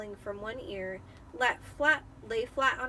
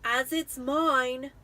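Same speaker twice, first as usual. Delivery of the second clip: furiously; 3 semitones higher, 6 dB louder, much slower than usual. Acted. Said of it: "said Harry furiously".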